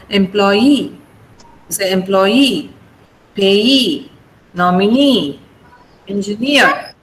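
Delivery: "employee", "payee", "nominee", "engineer" each with a long stressed ending; stress on last syllable. Shown as a demonstration. Teacher drilling "employee", "payee", "nominee", "engineer", last syllable stressed. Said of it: "stress on the last syllable".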